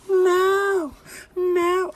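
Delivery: put-on high, loud whining child; whiny little boy. A grown man voicing a whining boy at the gate. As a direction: wail like a small kid refusing.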